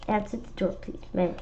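ordinary delivery, nothing marked

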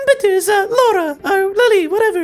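very high put-on girl voice, remorseful; high female game character, apologetic. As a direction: voice the girl way up, full of regret. Voicing game character Lillie.

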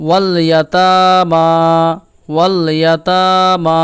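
"ওয়াল ইয়াতামা" slowly, long aa on "তা" and "মা"; full l in "ওয়াল", then drawn-out "ইয়াতামা"; model recitation. Shown as a demonstration. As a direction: stretch the last two vowels of "ইয়াতামা". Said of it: "আমরা এইভাবে পড়ব".

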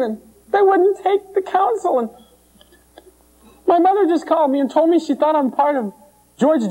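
whiny complainer. Retold or imitated. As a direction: play the frazzled church worker listing troubles. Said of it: "they go".

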